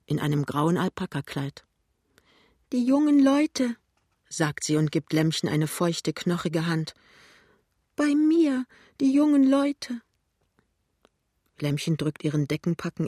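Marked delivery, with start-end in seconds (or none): 2.7-3.8 high, insistent, tearful voice; plaintive old woman. A narrator's reading. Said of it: "sagt die klagende Stimme". 8.0-10.0 high, insistent, tearful voice; plaintive old woman. A narrator's reading. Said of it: "sagt die klagende Stimme".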